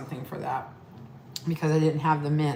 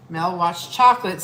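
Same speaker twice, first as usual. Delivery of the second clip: loud announcement; 8 dB louder than usual. Acted. Announcing the bar's name loudly.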